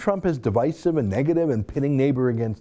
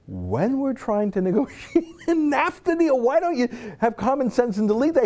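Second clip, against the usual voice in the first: exasperated laughing rebuke; 10 semitones higher than usual. Real, not acted. Host scolding a minister during trade talks, laughing exasperation. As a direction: scold her through a laugh.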